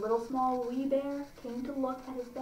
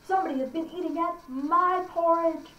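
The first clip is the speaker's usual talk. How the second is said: higher-pitched middle bear complaint; aggrieved middle-sized bear, pitched up; 5 semitones higher than usual. Acted. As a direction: lean on "my" as an indignant middle bear. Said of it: "the middle bear says".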